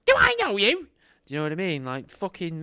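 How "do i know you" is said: very high, loud haughty snub; high loud icy stranger voice; cold brush-off. Retold or imitated. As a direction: freeze him out with a shrill-pitched put-down. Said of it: "she just stares at him and goes".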